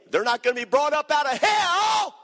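shouted high-pitched preaching crescendo; fiery revival preacher yelling; preaching at full force. Real, not acted. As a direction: lift it to a roaring pulpit shout.

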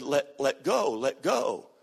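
low, understated voice from below. Retold or imitated. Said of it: "the voice from below said".

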